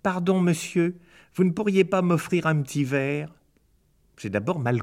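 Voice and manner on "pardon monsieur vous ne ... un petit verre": higher, small begging voice; little high voice pleading for a drink. Acted. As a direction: beg him timidly, much higher. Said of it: "une petite voix qui me suppliait".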